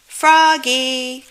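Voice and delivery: loud call across the patch. A narrator's reading. The teacher calls Froggy back, loud. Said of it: "called his teacher".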